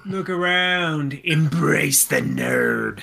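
slow, grand mock-solemn voice; slow hammed-up proclamation. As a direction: intone it slowly like a prophet of nerds. Mock solemn Geek Pride message.

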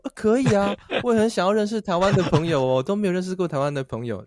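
put-on high, bright young woman agreeing; the lobby girl happily agrees, voiced high; Beijing girl's voice. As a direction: play her pleased and high-pitched. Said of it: "然后说".

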